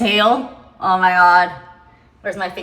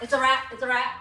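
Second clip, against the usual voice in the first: freaked out; 7 semitones higher, 9 dB louder than usual. Real, not acted. Freaking out over rat.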